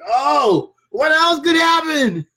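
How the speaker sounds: high, loud "what else" wail; exasperated outcry. Retold or imitated. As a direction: throw up your hands in disbelief. Glass breaks, stepping on it.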